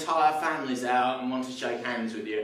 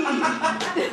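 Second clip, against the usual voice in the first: mock scream; 13 semitones higher than usual. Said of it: "she screamed".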